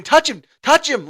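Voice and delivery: high, loud urgent yell; screaming at the game. Retold or imitated. Fan reliving shouting at players during the game, screamed. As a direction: yell it like a fan at a live play. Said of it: "I'm screaming".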